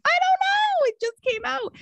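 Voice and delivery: squealed imitation of helpless disbelief; retold helplessness. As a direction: plead total cluelessness, imitating a friend. Friend helplessly defends her outburst.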